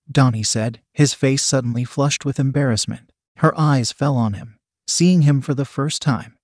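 plain reading voice

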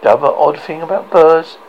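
plain reading voice

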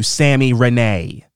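"Sammy Renee" drawn out slowly; emphasis on the name. Real, not acted. Host shouting out a reviewer, "Sammy Renee" drawn out with emphasis. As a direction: savor each syllable of "Sammy Renee". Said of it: "putting so much emphasis, emphasis on your name".

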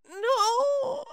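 long, high, wailing no; mock dismayed cry. Whole queue hearing weed ran out, mock dismay. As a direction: stretch it out like a crowd in despair. Whole line's imagined reaction.

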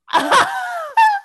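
very high, loud squeal of laughter; real, uncontrolled high cackle; shrieking laugh. Real, not acted. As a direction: burst out in a piercing laugh. Laughs at own punchline.

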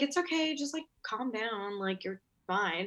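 soft, slowing reassurance; lightly imitating someone gently calming a nervous person; soothing. Receptionist to nervous job candidate, soothing.

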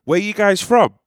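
loud, high, cheery driver's question; Uber driver voice. Acted. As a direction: play the chatty Uber driver, calling it out. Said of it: "this is the Uber driver".

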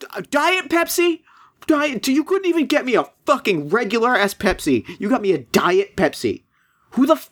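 high mock rant, rising to a near squeal on Pepsi; customer service rant. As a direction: rage at the clerk over a Diet Pepsi. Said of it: "starts screaming at him".

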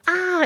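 sudden high yelp; high yelp of pain. Retold or imitated. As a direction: yelp like a cat just bit you. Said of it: "you're like".

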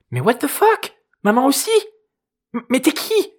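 put-on high-pitched panicked teen voice; panicked girl. A narrator's reading. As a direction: blurt it out as a startled, frightened girl. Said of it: "Une voix de gamine reprit, paniquée".

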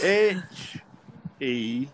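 letter by letter, long gaps; spelling out. Retold or imitated. Referee writing captain's name on card, spelling deliberately. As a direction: sound out each letter separately. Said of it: "spelling it out, by the way, sounding it out".

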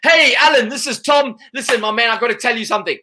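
eager friendly sales-call opener; friendly salesman. Retold or imitated. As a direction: mimic a chummy caller who grabs attention. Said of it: "someone says".

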